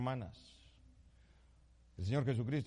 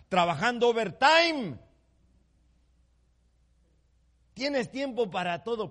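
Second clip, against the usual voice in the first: loud rebuke; 8 semitones higher, 7 dB louder than usual. Acted. Preacher voicing Jesus rebuking Martha.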